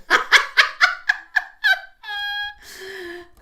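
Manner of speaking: long, high, loud laugh; real laughing fit. Real, not acted. Mother amused by her daughter's sleep talk, laughing. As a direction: let a high laugh run on and trail out.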